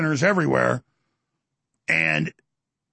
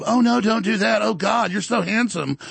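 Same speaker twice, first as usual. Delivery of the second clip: higher, slow, fawning come-on; seductive AI voice; 4 semitones higher than usual.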